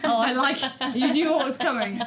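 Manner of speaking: ordinary talk, nothing marked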